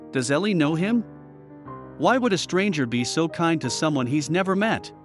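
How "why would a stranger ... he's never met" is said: ordinary delivery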